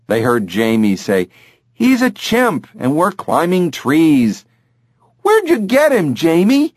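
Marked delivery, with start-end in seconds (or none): 1.7-4.4 put-on higher boyish voice; excited boy. Jamie tells his sister about the chimp, higher boy voice. 5.2-6.7 put-on much higher girlish voice; thrilled girl; excited girl. Daughter asks Jamie about the chimp, much higher girl voice.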